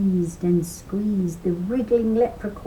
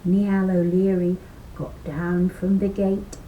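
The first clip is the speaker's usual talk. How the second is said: usual speaking voice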